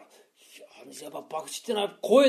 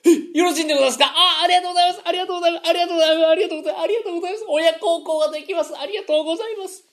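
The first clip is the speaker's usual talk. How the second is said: high, fast, laughing grateful thanks; bow and scrape with giddy gratitude; overjoyed thanks; 9 semitones higher, 4 dB louder, faster than usual. Acted. Gamblers thanking the boss, higher and giddy.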